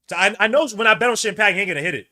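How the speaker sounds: loud, fast, frustrated yelling; a real fan's furious rant at the screen; angry shout. Real, not acted. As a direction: yell it out, fed up with losing the bet. Fan ranting about his bet.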